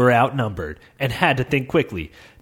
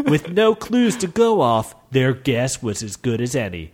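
ordinary delivery, nothing marked

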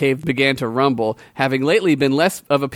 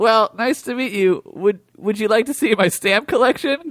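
put-on higher, eager little boy voice; sweet boy; 7 semitones higher than usual. Gregory welcoming the warrior to tea, eager childlike voice. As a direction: gush like an innocent child.

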